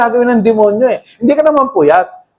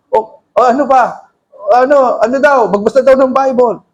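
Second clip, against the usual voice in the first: normal talking voice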